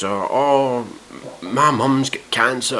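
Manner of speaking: drawn-out, hammed-up whimper; slow, badly faked sobbing complaint; mock crying. Mimicking a contestant crying about her mum, mock crying. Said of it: "fucking crying".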